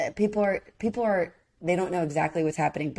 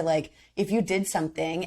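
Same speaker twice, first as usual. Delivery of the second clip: ordinary delivery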